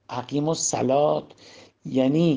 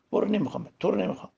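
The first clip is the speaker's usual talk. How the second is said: higher-pitched send-off without shouting; dismissive rejection; 6 semitones higher, much faster than usual. Shown as a demonstration. Preacher tells the ego it is unwanted, dismissive. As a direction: push it away with the words. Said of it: "این الله اکبر اینجوری بگین".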